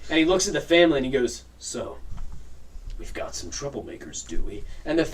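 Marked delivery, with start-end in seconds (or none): 1.6-4.6 low, softer captain's threat; quiet menace. Acted. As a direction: let the accusation hang, low.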